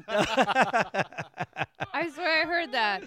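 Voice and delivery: talking through a mouthful of banana; laughing with food in mouth; mouth full. Real, not acted. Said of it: "I had a banana in my mouth".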